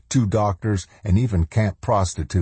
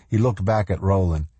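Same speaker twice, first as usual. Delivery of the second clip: ordinary delivery, nothing marked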